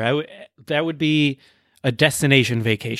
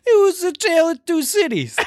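high-pitched mock Cockney sliding into New York; bad Cockney turned New York. Asked for Dickens titles in Cockney, comes out as high New York accent. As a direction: botch a Cockney accent, much too high. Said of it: "this is very New York".

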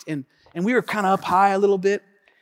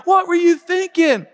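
crowd jeering; 10 semitones higher, 3 dB louder than usual. Retold or imitated. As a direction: jeer at the fools.

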